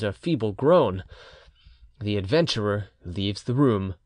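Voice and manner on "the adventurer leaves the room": ordinary delivery, nothing marked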